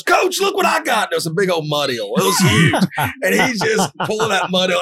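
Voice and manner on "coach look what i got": put-on excited kid. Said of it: "Grungy goes".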